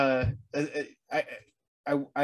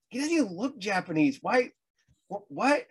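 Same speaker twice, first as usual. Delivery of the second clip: incredulous; 7 semitones higher than usual. Retold or imitated. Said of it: "And I'm like".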